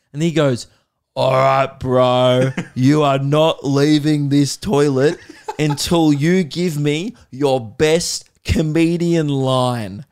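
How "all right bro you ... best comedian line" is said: loud, slow, drawn-out challenge; demanding pub bloke. Retold or imitated. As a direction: imitate a bloke laying down an ultimatum, slowly and loudly. Voices the man demanding a joke.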